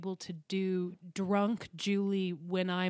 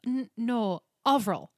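"Avril" punched louder with falling tone; patient correction; 3 semitones higher, 5 dB louder, slower than usual. Retold or imitated. Classmate correcting her pronunciation, retold.

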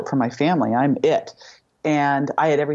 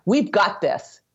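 acted surgeon's assurance, higher pitch; confident reassurance; 6 semitones higher, slower than usual. Surgeon assuring a frightened mother, confident. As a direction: lightly imitate a breezily confident surgeon.

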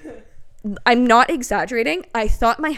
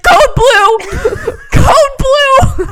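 very high, loud screamed alarm; re-enacted hysterical cry for help; frantic emergency shout; 12 dB louder, much slower than usual. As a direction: yell for help down a hallway. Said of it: "running out to the freaking hallway".

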